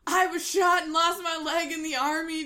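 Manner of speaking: loud, high, angry yell of a caller; retold yelling caller. Angry caller yelling at a telemarketer, loud shout. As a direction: shout it like a furious caller on the line. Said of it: "just people yelling at me".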